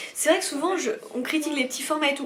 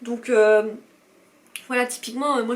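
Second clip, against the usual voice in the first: ordinary talk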